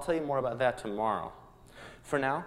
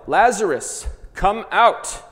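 a big commanding shout, much higher and louder; yelled command; 9 semitones higher, 10 dB louder, much slower than usual. Acted. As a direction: call the dead man out of the tomb. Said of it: "Jesus yelled".

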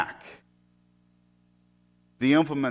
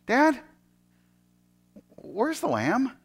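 put-on higher boyish question; Isaac asking; 7 semitones higher, 3 dB quieter, slower than usual. Son asking father about the lamb, boyish. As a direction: ask the hard question plainly.

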